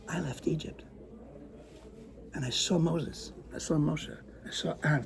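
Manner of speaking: soft, mysterious dream telling; whispered confession of a dream; hushed secret. Acted. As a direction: draw them in quietly. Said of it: "I lowered my voice even more".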